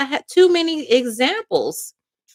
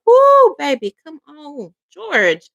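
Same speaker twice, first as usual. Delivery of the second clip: excited whoop; 4 semitones higher, 8 dB louder, slower than usual. Real, not acted. Reader cheers about the cards.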